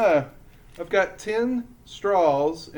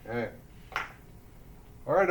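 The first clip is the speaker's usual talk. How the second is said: ordinary delivery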